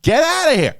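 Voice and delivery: high, loud get-out shout; shooing yell. Retold or imitated. Shooing the squirrel out of the office, high loud shout. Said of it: "I just said".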